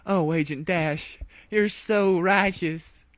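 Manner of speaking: high, slow adoring sigh; hammed-up lovestruck swoon; swooning mare. A narrator's reading. Applejack swooning over Dash, high and slow.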